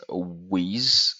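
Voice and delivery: WITH with its th turned into a z; French-learner "wiz" for WITH; French mispronunciation. Shown as a demonstration. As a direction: say WITH as "wiz", buzzing z at the end. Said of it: "French speakers struggle with the the sound".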